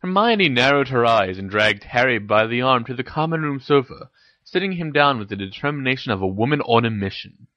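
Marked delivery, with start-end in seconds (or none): none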